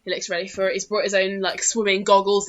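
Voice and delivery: normal talking voice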